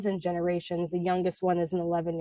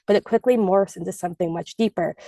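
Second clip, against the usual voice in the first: ordinary talk, nothing marked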